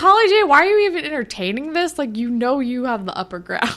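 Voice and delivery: fast, high exasperated burst, easing off; incredulous plea to a TV character. Retold or imitated.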